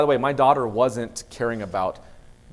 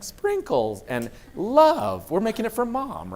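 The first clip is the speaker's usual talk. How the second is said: sweet daughter voice; 10 semitones higher, 3 dB louder, slower than usual. Retold or imitated.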